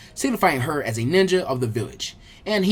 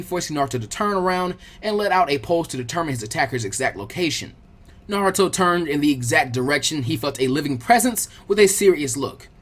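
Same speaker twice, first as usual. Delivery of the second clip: plain reading voice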